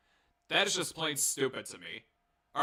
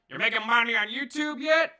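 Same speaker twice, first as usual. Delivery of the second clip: high, louder mock voice of the caller; 8 semitones higher, 6 dB louder than usual. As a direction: put on a teasing caller voice.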